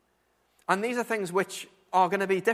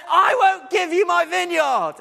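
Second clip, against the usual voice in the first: big angry yell, much higher and louder; Ahab's furious shout; 11 semitones higher, 11 dB louder than usual. Retold or imitated. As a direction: bellow the refusal at her. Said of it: "he just yells".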